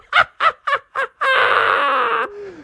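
high squealing laugh; gleeful high laugh. Retold or imitated. Delighted mom cackling about taco pizza.

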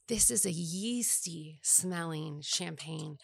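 slow, hushed tasting whisper. Real, not acted. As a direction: whisper it like a wine snob savoring. Smelling champagne, sommelier tone.

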